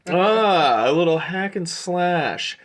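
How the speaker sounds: high, loud, drawn-out merchant's delight; delighted merchant Aldor. Acted. Aldor admires a customer's request for a blade upgrade, delighted merchant. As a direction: relish it like an eager shopkeeper.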